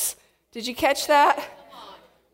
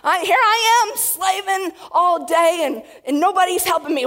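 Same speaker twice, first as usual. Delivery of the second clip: high, loud, harried hostess yelling at the household; preacher voicing Martha's frantic kitchen shouting; frazzled mock scream; 7 semitones higher, 4 dB louder than usual. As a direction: play the stressed-out host barking orders at everyone. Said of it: "screaming at everybody".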